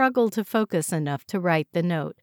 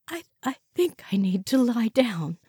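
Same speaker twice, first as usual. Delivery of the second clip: faltering sick woman; 4 semitones higher than usual. A narrator's reading. Joyce faltering, nauseous.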